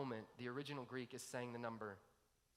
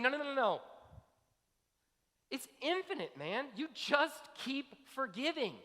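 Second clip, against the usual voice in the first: emphatic insistence; 11 semitones higher, 6 dB louder than usual. Retold or imitated. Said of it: "He's saying".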